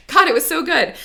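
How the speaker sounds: fighting back happy tears; getting emotional. Real, not acted. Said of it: "oh, I'm getting emotional".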